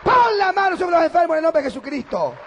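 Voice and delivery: loud, high shouting sermon, slow on the climaxes; fiery pulpit shout; preaching at full force. Acted. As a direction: thunder it to the congregation.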